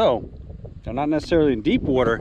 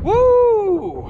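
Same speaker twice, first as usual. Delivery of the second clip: excited whoop; 6 dB louder than usual. Real, not acted. Celebrating the fish.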